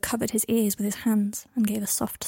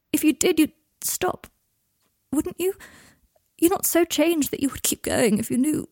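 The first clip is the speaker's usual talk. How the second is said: Draco, wounded; 4 semitones higher than usual. Acted. Draco tells Harry he spat on his soul, raw. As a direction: lash out from a broken heart.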